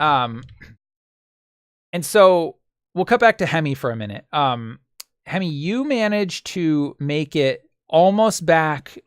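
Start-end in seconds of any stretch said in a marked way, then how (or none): none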